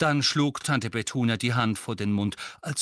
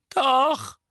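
high, mumbled Dudley voice; Dudley mumbling; 9 dB louder than usual. A narrator's reading. Dudley unexpectedly admits it to his parents, mumbling. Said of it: "murmelte Dudley unerwartet".

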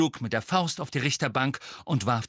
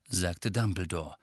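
usual speaking voice

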